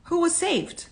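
high, eager question; acted urgency, pitch well up; excited bystander. A narrator's reading. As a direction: call out for news from the crowd. Crowd member asking for news.

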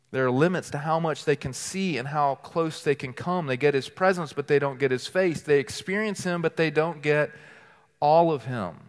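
ordinary talk

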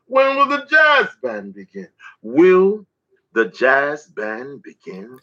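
rhythmic, declaiming poem delivery; spoken word poetry. Acted. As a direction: perform it as a slam poem, riding the beat.

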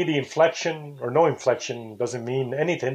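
normal talking voice

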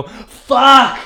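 high, loud drawn-out curse; explosive frustrated scream, reenacted; frustrated loud yell. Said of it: "Like, really loud".

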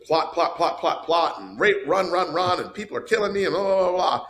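mock-hectic, high recap; rattled-off list. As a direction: pitch climbing through a breathless-sounding recap. Rattling off the film's busy plot.

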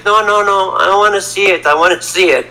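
retold urgent refusal and demand; insistent mother. Voicing mother refusing then insisting.

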